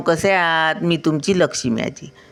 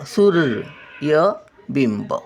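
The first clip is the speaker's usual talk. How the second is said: सूर्यबिंब at half speed, syllable by syllable; slow syllable reading; much slower than usual. Shown as a demonstration. Grandmother sounding out सूर्यबिंब for children, slowly. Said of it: "word then slow repeat".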